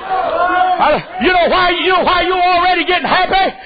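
shouting fervent preacher; preaching. Acted. Preaching at full force.

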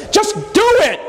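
high exasperated outburst; God blowing up. Retold or imitated. God fed up with Moses, bursting out high. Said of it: "the Lord blew up".

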